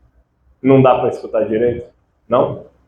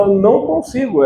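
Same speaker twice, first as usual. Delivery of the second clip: higher-pitched plaint of a struggling learner; frustrated student; 8 semitones higher than usual. Acted. Student saying they can't copy the audio, mild frustration. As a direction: lightly act out a learner giving up.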